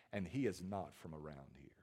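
hushed, voiceless aside; low whispered line. Acted. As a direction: breathe it like a secret.

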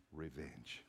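one low, very soft word; quiet, grim answer. Acted. Crowd answering why they are on the mount, quiet grim answer.

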